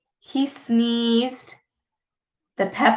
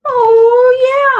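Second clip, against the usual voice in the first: sympathetic aww; 11 semitones higher, 8 dB louder than usual. Real, not acted. Sympathetic cry over bear.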